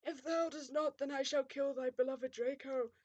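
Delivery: put-on pompous villain voice; threatening villain. A narrator's reading. Voldemort threatening to kill Draco, villain voice. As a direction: intone it as a grand threat.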